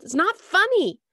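It's not funny shouted high and loud, falling hard on funny; yelled. Acted. Molly yells It's not funny at Michael, high and loud. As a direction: yell it, stamping on funny. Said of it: "I yelled".

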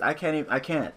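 ordinary delivery

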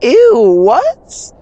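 loud, high-pitched "ew" of disbelief; recoil, voice leaping up high; disgusted shock. Real, not acted. Reacting to a shock in game.

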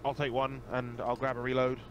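ordinary talk, nothing marked

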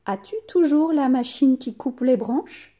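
rising question tune on "branches?"; question intonation. Shown as a demonstration. Teacher modelling a question for pupils, voice rising. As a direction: let the voice climb on "as-tu toujours la machine qui coupe les branches?". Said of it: "Ma voix, elle monte".

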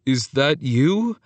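slow, hesitant question; a touch of wobbly uncertainty. A narrator's reading. Antonio unsure it is his cousin, slightly wobbly. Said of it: "he asked in a wobbly voice".

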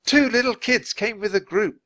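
high, loud exasperated shout. A narrator's reading. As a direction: shout it into the radio. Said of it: "Nancy shouted".

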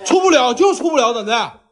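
voix qui monte très haut dans la dispute; cri agacé. Real, not acted. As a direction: refuse en criant, bien plus aigu. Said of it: "你喊啥呀你？".

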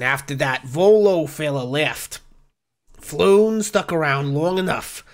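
put-on weary bartender with a northeastern US accent; sigh, then dish it out in northeastern US barkeep talk. Bartender tells Oz who met Floon, northeastern US accent. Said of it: "have the exact same accent".

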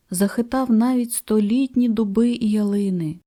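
ordinary delivery, nothing marked

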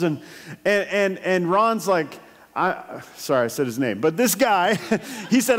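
ordinary talk

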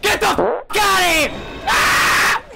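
startled yell at the screen; panicked shout. Real, not acted. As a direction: scream it in a panic. Jump scare in game.

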